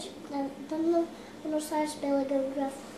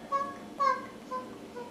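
tiny high hoof clip-clop; 10 semitones higher, 4 dB quieter than usual. Acted. Little goat's hoof sounds.

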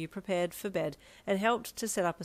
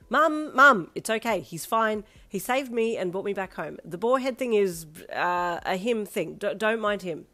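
loud, flustered reassurance; agitated, faltering babble; rambling; 3 semitones higher, 8 dB louder than usual. Acted. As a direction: stumble through a hasty explanation. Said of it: "you rambled quickly".

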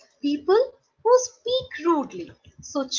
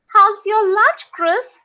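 Peter; 5 semitones higher, 5 dB louder than usual. A narrator's reading. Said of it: "Peter asked".

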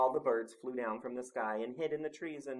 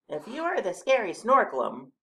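higher, louder little animal voice; Mole's voice; 10 semitones higher, 8 dB louder than usual. A narrator's reading. Mole questioning the monster, high character voice. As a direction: challenge the monster in a small creature's high voice. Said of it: "said Mole".

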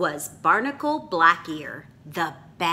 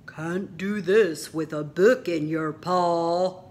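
lightly gruff pirate; 4 dB louder than usual. Acted. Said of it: "Bartholomew Bunny said".

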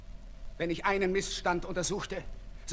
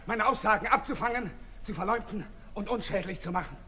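usual speaking voice

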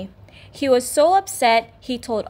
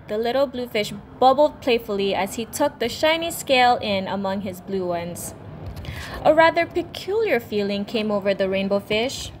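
plain reading voice

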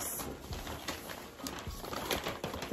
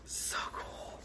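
voiceless, hushed amazement; awed whisper; 18 dB quieter than usual. Real, not acted. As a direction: whisper it after a gasp. Awed by gift after a gasp.

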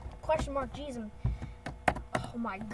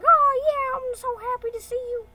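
high, loud, gushing character voice; happy stickwoman; 11 semitones higher, 12 dB louder than usual. Acted. Stickwoman greeting someone she's happy to see, gushing. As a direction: gush it in a high cartoon voice.

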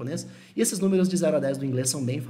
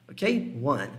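ordinary delivery

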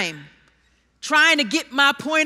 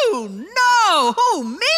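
mock surprise; 3 semitones higher, 3 dB louder, slower than usual. Peter's mock protest in sermon.

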